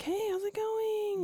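bright fake cheer at a silent teen; overly cheery parent. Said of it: "Would you be like".

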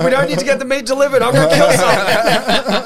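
high, loud, laughing mock threat; laughing, over-the-top threat; manic mock threat. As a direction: ham up an unhinged teen. Acted manic quote, laughing.

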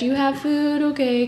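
a hint of a carefree dog. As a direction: play the dog, happy with anyone who feeds it. Voicing the indifferent dog.

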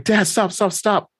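high-pitched urgent protest; retold frantic interruption; mock panicked plea. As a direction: burst in begging Dad to stop, acted out. Daughter bursting in, pleading in retelling.

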